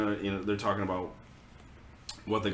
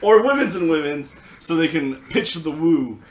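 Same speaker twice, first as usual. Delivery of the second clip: higher and louder, giggling through it; laughing riff; 7 semitones higher, 8 dB louder than usual. Pretend. Riffing with a friend on the kids' dating speech, giggling. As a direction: play along, cracking up.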